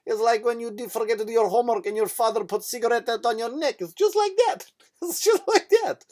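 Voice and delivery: hammed-up high Russian accent; blunt Russian comparison, shooting up on the punchline. Russian nurse compares the needle to childhood punishment, mock Russian accent. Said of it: "Russian people are tough people".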